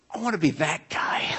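breathy, hushed whisper of longing; awed whisper to himself; whispered wish. Retold or imitated. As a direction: whisper it like a secret hope. Quiet longing on the couch.

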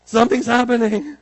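high, loud mock-frantic cry; comic panicked wail on words. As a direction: cry out in hammed-up alarm. Acted mother in turmoil.

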